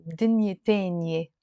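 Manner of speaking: Lebanese dialect, 'teh-nyeh' for تانية; Lebanese pronunciation. Shown as a demonstration. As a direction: say بدنيا تانية with Lebanese 'eh' vowels. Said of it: "I will pronounce it more".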